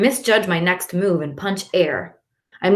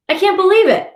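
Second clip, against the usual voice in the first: much higher, louder amazement; amazed; 13 semitones higher, 6 dB louder than usual. A narrator's reading. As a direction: burst out in disbelief. Sees favorite treat.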